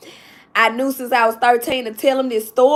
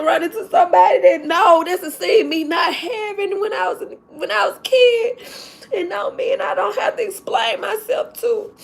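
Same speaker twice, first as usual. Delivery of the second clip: tearful, voice breaking and high; fighting back happy tears; crying while talking; 6 semitones higher than usual. Real, not acted. Teacher tearfully telling viewers about meeting her old principal, crying. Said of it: "I'm so sorry to cry".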